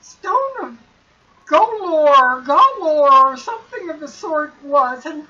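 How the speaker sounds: high, rising panicked rambling; frightened young man. Acted. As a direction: voice climbing into a cry. Floon voiced, frightened and confused.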